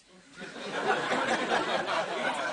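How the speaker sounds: loud airy laughter; breathy laugh. Real, not acted. Speaker laughing loudly at his own cow joke.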